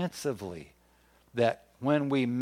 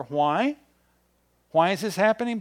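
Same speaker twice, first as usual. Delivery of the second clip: rising rhetorical question; 6 semitones higher, 6 dB louder than usual. Real, not acted. Preacher's rhetorical question to congregation.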